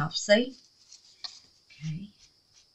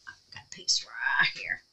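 whisper; 10 semitones lower, 15 dB quieter than usual. Real, not acted. Whispering while working carefully.

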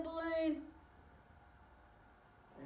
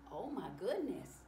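usual speaking voice

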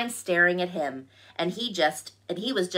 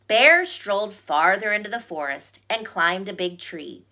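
ordinary delivery

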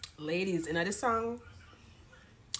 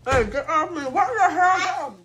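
loud startled protest; louder, high, shocked; 9 semitones higher, 9 dB louder, slower than usual. Real, not acted. Child climbing on him.

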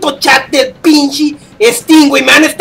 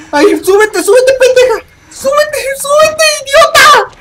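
high slow desperate cries; desperate panic; 11 semitones higher than usual. Real, not acted. Gamer climbing to escape the monster, high desperate cries. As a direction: beg yourself to climb in terror.

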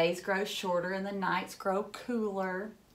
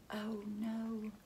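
soft sad sigh; 10 dB quieter than usual. A narrator's reading. Sad reaction to broken plant.

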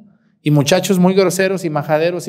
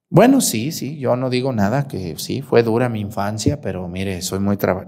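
imitated mother conceding; 8 semitones lower, 3 dB quieter than usual. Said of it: "le digo".

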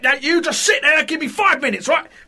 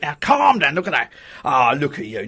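soothing; 11 semitones lower than usual. Acted. Coaxing him to lie down.

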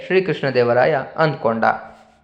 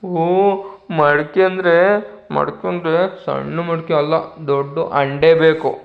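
put-on fussy child. Tenali as a child insisting on a big vessel, in a pouting child voice. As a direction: insist like a stubborn kid. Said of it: "ನಾನು ಆ ಮಗುವಿನ ಹಾಗೆ ಇಲ್ಲಿ ನಟಿಸ್ತೀನಿ".

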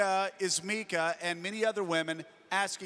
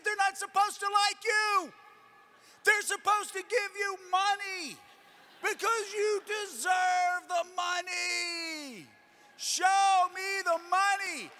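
high, drawn-out holler; retold exasperated shout; 9 semitones higher, slower than usual. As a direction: hammer it home, stretching the final words. Retold pep talk to crying colleague.

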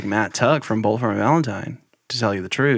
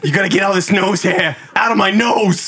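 mock rant; 8 semitones higher than usual. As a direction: whine it up like a hammed-up comic. Playing Joe Rogan in the bit.

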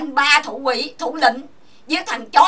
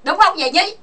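usual speaking voice

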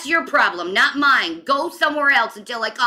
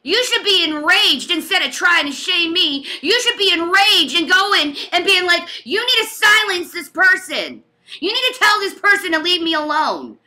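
real indignant outburst, pitched higher; impassioned angry scolding; raised voice; 4 semitones higher, 3 dB louder than usual. Real, not acted. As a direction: raise your voice in outraged protest. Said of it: "I'm raising my voice".

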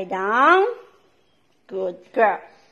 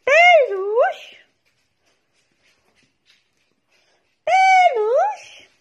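much higher, rising call of the dog's name; 13 semitones higher, 3 dB louder, much slower than usual. Real, not acted. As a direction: sing the pet name up high to the dog. Calling dog by pet name.

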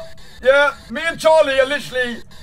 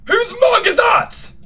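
sudden high shout; startled shout; 9 semitones higher, much slower than usual. Real, not acted. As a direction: blurt it out, alarmed. Startled outburst at a sound.

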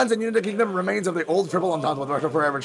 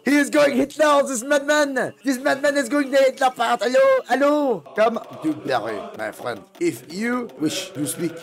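mock French accent; 5 semitones higher than usual. French leader reacts to a rival and invites a visitor, in a mock French accent. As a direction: switch from alarm to welcome, French lilt.

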